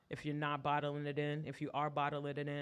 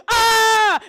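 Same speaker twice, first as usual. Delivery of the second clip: loud, high cathartic scream; full scream; 24 dB louder than usual. Acted. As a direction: let it all out in one scream.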